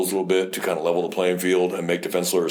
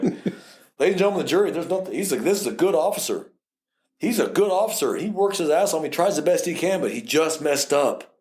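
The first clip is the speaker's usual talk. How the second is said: pitched high, smooth, sweet-talking lawyer; a lawyer buttering up the jury; mock defense lawyer; 7 semitones higher than usual. Lawyer to jury, oozing fake praise for the officer. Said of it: "they'll even say".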